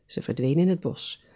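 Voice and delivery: ordinary delivery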